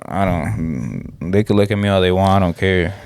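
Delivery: normal talking voice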